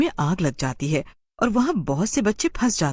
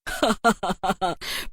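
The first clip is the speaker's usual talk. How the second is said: happy witch laugh. Acted. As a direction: let out a delighted little cackle. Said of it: "चुड़ैल खुश हो जाती है".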